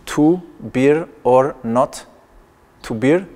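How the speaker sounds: deliberate, slowed-down reading; slow reading aloud. Shown as a demonstration. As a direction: read the phrase out very slowly, word by word. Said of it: "so this reads".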